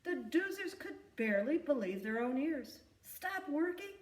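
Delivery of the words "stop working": ordinary delivery, nothing marked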